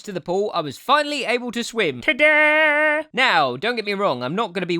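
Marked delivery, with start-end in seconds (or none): 2.0-3.0 high, stretched-out showman's flourish; triumphant ta-da. Acted. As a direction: announce it like a proud reveal. Triumphant reveal after learning to swim.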